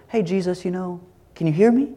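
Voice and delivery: over-polite, tiptoeing wake-up voice; nice and gentle. Acted. Disciples politely rousing a sleeping Jesus, mock gentle. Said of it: "This isn't like a nice, gentle".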